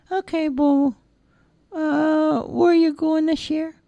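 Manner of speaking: put-on high, childlike simple lad voice; cheerful high-pitched lad; Charlie's voice. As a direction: play the big innocent lug, high and eager. Charlie asking Bobo.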